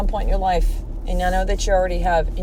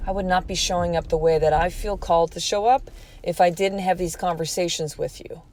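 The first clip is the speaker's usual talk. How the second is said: ordinary talk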